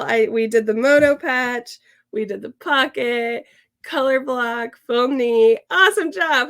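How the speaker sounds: loud, proud celebration; excited cheering. Real, not acted. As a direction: cheer yourself on. Celebrating finished sewing project.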